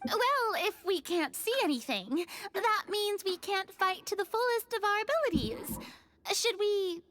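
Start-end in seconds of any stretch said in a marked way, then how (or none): none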